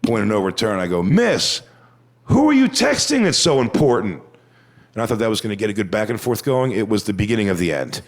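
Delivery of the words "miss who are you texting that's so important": louder, higher call-out to a heckler. Retold or imitated. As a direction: project it across the room, half mocking. Calling out heckler on stage.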